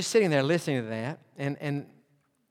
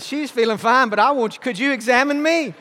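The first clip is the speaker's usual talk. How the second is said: much higher, louder mock plea; joking plea; 8 semitones higher, 7 dB louder than usual. Son asking the nurse to examine him instead, mock pleading. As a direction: play it up for laughs.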